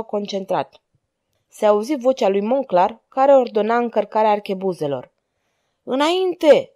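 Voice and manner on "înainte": raised, higher-pitched rallying call; shouted order. A narrator's reading. Manfred urging his men toward the street, shouting. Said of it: "țipă Manfred".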